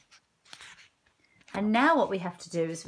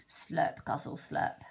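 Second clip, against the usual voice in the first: low, whispered slurp and guzzle noises; slurping sounds; 6 semitones lower, 5 dB quieter, slower than usual. Acted. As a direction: slurp it like licking honey off paws. Badger licking sticky hands.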